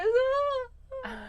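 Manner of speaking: high, loud mock sob; imitated cry of an overcome owner; imitated wail. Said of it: "And she goes".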